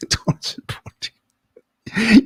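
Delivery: fake-cry for the poor animal; mock sobbing. Pitying the shot deer.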